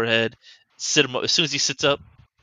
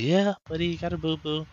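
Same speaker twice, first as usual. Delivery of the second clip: put-on higher, sing-song comfort to a toddler; tender baby talk; 7 semitones higher than usual. Mother soothing her son about his boo-boo, higher baby talk. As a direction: coo it to a hurt little boy.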